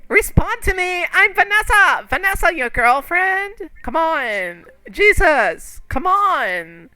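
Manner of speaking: high, loud pleading calls; desperate Vanessa. Acted. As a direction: beg him to wake up in a high pitched voice. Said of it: "She's like".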